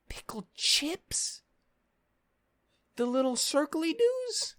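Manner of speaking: very high, slow, mocking disbelief; incredulous disbelief. Real, not acted. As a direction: squeak it out in outrage. Incredulous rant about pickles.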